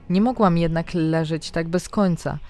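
ordinary delivery

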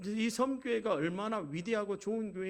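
ordinary talk

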